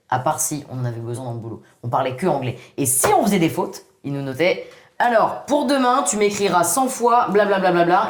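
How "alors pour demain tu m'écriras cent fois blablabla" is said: higher, mock-bossy teacher giving homework; mock strict teacher. Mimicking her boss assigning punishment lines, mock strict.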